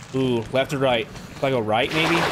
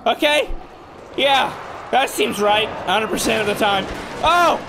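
rattled; 6 semitones higher than usual. Real, not acted. Rattled after the attack.

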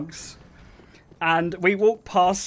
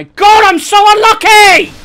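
slow, loud groan building to a high scream; frustrated yell; 14 semitones higher, 15 dB louder, slower than usual. Real, not acted. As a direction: yell in real exasperation at bad luck. Frustrated outburst during game.

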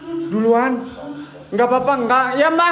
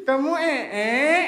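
usual speaking voice

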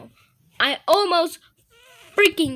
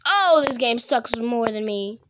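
normal talking voice

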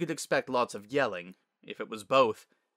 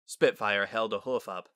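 ordinary delivery, nothing marked

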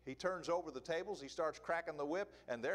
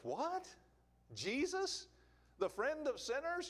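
much higher, incredulous questions; mock disbelief; 10 semitones higher, slower than usual. Preacher voicing a doubting congregant, mock disbelief. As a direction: play the shocked listener who can't believe it.

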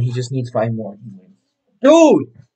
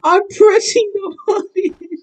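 high-pitched raging whine; raging gamer; slower than usual. Real, not acted. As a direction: squeal it in frustration, slowly. Rage at an unresponsive game.

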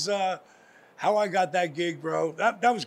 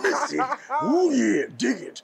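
high gravel-free Macho Man drawl, louder and higher; 5 semitones higher, 4 dB louder, slower than usual. Retold or imitated. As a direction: ham up the Macho Man club commercial. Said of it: "Macho had never been there".

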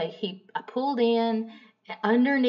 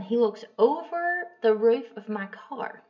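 ordinary talk, nothing marked

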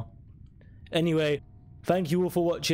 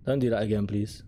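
ordinary talk

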